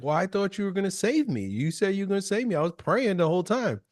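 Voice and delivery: drowned man whining to God; whiny complaint. Retold or imitated. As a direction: complain like he was cheated. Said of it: "And he's like".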